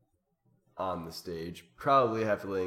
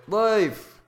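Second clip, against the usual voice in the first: higher and louder, called out from the crowd; shouted suggestion; 11 semitones higher, 8 dB louder than usual. Shown as a demonstration. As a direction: shout it like an audience member. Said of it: "this is supposed to be I'm an audience member".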